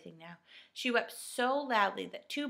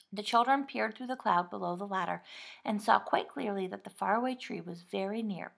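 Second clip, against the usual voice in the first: plain reading voice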